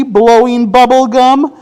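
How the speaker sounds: plain reading voice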